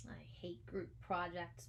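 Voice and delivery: muttered. Acted. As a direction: grumble it to yourself so the teacher won't hear. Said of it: "muttered Kelly".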